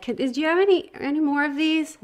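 high, eager, hopeful ask; bright excited question to the shop lady; hopeful high-pitched asking. Retold or imitated.

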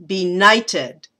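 be-NIGHT-ed, the middle punched; "Benighted" said deliberately with its second syllable stressed. Shown as a demonstration. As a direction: lean hard on NIGHT in "Benighted". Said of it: "benighted has the stress in the middle".

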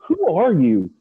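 high, loud mock challenge; incredulous demand. The guy to the unknown newcomer, incredulous mock demand. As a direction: imitate a baffled trader demanding an answer.

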